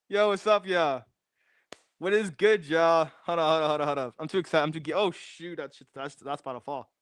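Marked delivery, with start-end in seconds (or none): none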